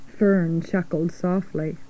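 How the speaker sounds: plain reading voice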